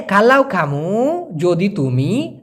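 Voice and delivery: very slow, each word drawn out; slow breakdown. Shown as a demonstration. Teacher glosses the Malay phrase for students, very slowly. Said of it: "slow word-by-word breakdown".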